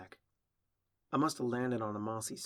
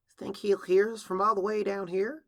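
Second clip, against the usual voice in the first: put-on high, louder young pony's question; doubtful filly; 10 semitones higher, 8 dB louder than usual. Filly stuck in the well asking Applejack, high doubtful question. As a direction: ask doubtfully, pitched well up.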